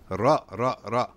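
"ra" with a dark, backed vowel; heavy ra. Shown as a demonstration. Teacher drills the exception letter, heavy vowel. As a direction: punch each "ra" full and heavy. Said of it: "effect on the alif and the fatha".

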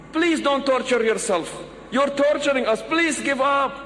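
high-pitched pleading mock yell; storyteller acting out anxious shouting. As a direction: beg them urgently to give up. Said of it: "the friends start yelling".

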